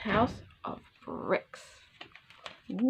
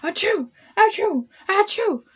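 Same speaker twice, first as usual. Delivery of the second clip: loud, high, repeated achoos; hammed-up wolf sneezing fit; 10 semitones higher, 5 dB louder than usual. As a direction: sneeze hard three times over. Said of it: "huffing and puffing and sneezing".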